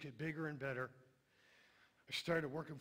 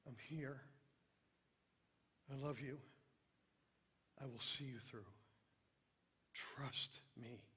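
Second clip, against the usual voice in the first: still, soft voice; 2 semitones lower, 6 dB quieter than usual. Acted. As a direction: speak like a still, soft voice reassuring. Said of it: "It's the still, soft voice".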